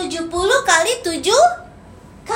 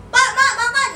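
high, louder call across the room; yelling from afar; 7 semitones higher, 3 dB louder than usual. Shown as a demonstration. Child calling parents to eat from afar, high loud yell. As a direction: yell it across the house, the wrong way. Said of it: "Nggak gitu, harus samperin".

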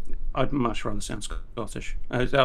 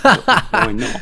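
indignant retort; 8 semitones higher, 10 dB louder than usual. Retold or imitated. Retold quarrel with support.

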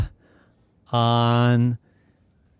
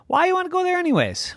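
high, quick, nosy question; old woman questioning; much faster than usual. Acted. Old woman quizzing the llama, high and quick. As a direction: pipe up in a high, hurried voice.

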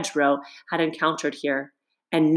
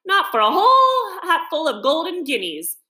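laughing heartily; 10 semitones higher than usual. A narrator's reading. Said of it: "laughing heartily".

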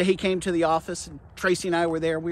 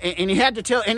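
ordinary delivery

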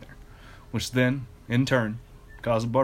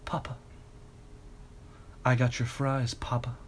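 sultry whisper; 8 dB quieter than usual. A narrator's reading. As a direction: coo it quietly and seductively. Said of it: "Alfred whispered in a sultry voice".

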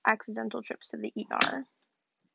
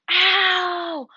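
loud high cry of pain; real shout of pain; sharp pained yelp; 8 semitones higher, 10 dB louder than usual. Real, not acted. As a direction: yelp as the wrist hits. Real injury while filming.

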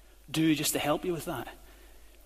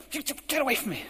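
mock shooing away; 7 semitones higher than usual. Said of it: "going along the street saying".

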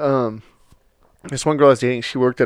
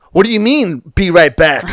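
mock indignation; 3 semitones higher, 3 dB louder than usual. Acting out annoyance at texts.

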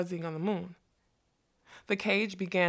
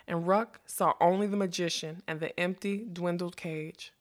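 ordinary delivery

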